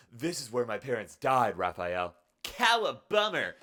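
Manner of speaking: mock dramatic quote. Acts out comic panel quote.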